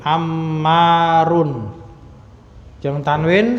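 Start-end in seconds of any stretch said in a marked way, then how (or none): 0.1-1.7 "Ammarun" said very slowly, long mm; slow demo. Shown as a demonstration. Teacher models the alif word for students, very slow. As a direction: draw out "Ammarun" for the class. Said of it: "ada alifnya".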